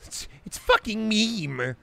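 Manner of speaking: high, slow put-on voice saying meme as "meh-mee"; defiantly mangle meme; insisted mispronunciation. Shown as a demonstration. Streamer mocking people who get mad, in a high slow voice. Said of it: "People get so mad when you mispronounce meme".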